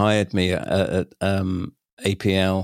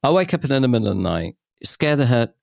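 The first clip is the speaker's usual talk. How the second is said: ordinary delivery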